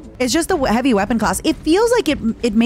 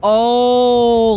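loud, higher drawn-out "oh"; excited oh; 4 semitones higher, 6 dB louder than usual. Real, not acted. Streamer thrilled by the new threat, long loud excited oh.

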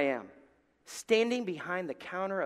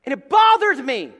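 louder and higher, emphatic; 8 semitones higher, 15 dB louder than usual. Real, not acted. Preacher stressing his own irritation, loud and high. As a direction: hammer the point home. Repeat of line 54, now loud.

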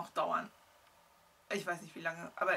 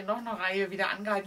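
normal talking voice

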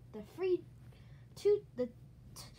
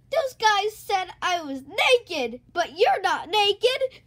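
loud, slow acted shouting; angry complaint; 4 semitones higher, 11 dB louder, slower than usual. Gorilla tells on those who called him naked, angry shout. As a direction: protest at full volume, word by word.

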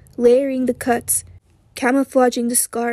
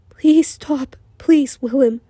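begging; 2 semitones higher, slower than usual. A narrator's reading. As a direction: beg him to stop. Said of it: "He had begged and begged".

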